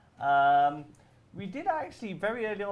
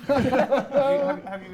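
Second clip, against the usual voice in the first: high, loud laughing tease; teasing a colleague, laughing; 12 semitones higher, 14 dB louder than usual. Real, not acted. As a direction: giggle through the question.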